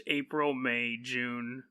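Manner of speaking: ordinary talk, nothing marked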